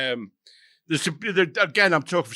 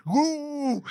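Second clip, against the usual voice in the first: much higher, drawn-out ooh; Bernie's comic ooh; 12 semitones higher than usual. Retold or imitated. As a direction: peek through the door and coo it high.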